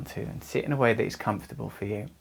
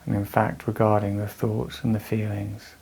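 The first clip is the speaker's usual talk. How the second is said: measured meditation voice with long pauses; guided meditation. Real, not acted. Teacher guiding anxious listeners through breathing, calm meditation voice. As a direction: guide them calmly through the breath, leaving space between phrases.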